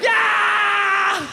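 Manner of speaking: long, very high held yell; hammed-up climax of a cheering fan; ecstatic cheer. Comic acting a fan answering the goal, ecstatic shout.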